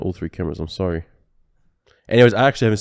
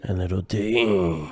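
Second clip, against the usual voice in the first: radio voice; 4 dB quieter, much slower than usual. Pretend. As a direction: echo it back like a radio announcer. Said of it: "But in a radio voice".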